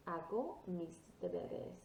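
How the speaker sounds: slow model "Hago mis deberes" with no H; silent H. Shown as a demonstration. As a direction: say "Hago mis deberes" slowly for repeating, "Hago" starting on A. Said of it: "your H is always silent".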